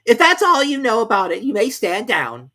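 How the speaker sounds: high loud king; king dismissing. A narrator's reading. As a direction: send him off. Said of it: "continued the king".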